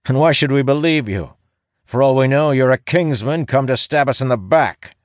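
high, forceful guard's challenge; suspicious guard. A narrator's reading. Guard doubting a newcomer's word, suspicious and loud.

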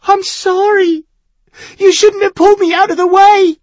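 fast, high, loud tearful plea; a distraught boy crying it out; sobbing. A narrator's reading.

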